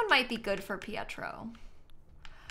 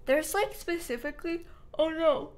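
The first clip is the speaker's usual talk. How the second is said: higher voice stretched by a yawn; talking through yawn; 6 semitones higher than usual. Real, not acted. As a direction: let a real yawn take over mid-sentence. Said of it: "I keep yawning".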